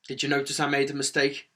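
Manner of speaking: ordinary talk, nothing marked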